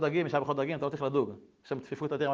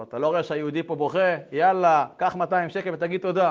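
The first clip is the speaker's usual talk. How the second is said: loud, pushy urging; 3 semitones higher, 8 dB louder than usual. Retold or imitated. The father pushing the officer to take two hundred, loud. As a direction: press him to take the money.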